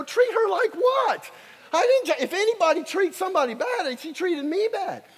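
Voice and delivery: high, loud, outraged cry; indignant shout. Retold or imitated. Preacher to customs lady, indignant high shout. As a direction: fire back at the accusation. Defending himself to customs officials.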